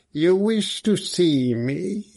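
a hint of harsh metal, asked very slowly; harsh, metallic voice. A narrator's reading. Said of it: "He had a harsh, metallic voice".

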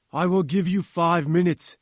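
loud, breathless furious threat; panting fury. Acted. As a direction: pant it out in rage. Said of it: "he panted in his fury".

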